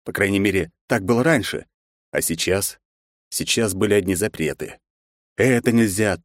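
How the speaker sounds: ordinary delivery, nothing marked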